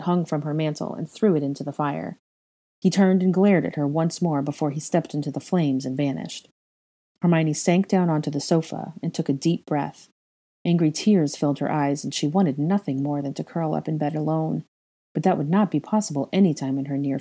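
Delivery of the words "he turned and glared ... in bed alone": ordinary delivery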